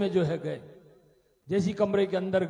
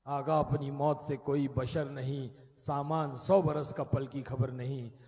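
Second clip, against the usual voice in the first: poem recital; 4 semitones lower than usual. Acted. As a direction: recite the couplets gravely. Recited couplets.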